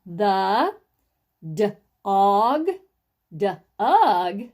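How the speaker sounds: loud, separated word-by-word reading of "The, d-og, d-ug"; sounding out. Shown as a demonstration. Teacher models reading for the class, sounding out. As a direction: split the D off "og" and "ug". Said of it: "after we've sounded it out".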